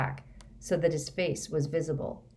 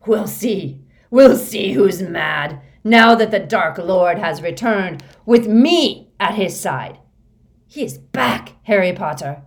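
crazed, triumphant shouting; voice rising uncontrollably; 3 semitones higher, 12 dB louder than usual. A narrator's reading. Moody gloating over Harry about the Dark Lord, voice rising uncontrollably. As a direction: rant at full volume, drawing out the key words. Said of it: "his voice rising uncontrollably".

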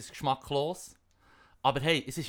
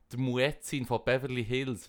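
ordinary talk

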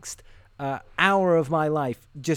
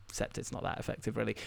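under the breath, almost a whisper; voiceless aside to the mic; muttered aside; 6 semitones lower, 12 dB quieter, faster than usual. Real, not acted. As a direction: drop to a private mutter.

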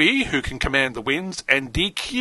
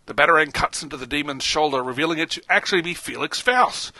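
ordinary talk

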